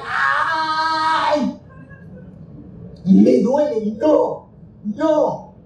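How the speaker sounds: high yelping cry of pain; a high pained shout, ay, it hurts. Retold or imitated. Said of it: "tengo un grito tal".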